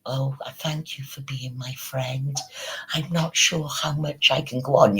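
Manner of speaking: mock emotional pleading. Imagining the woman's emotional messages to Frank, mock emotional pleading.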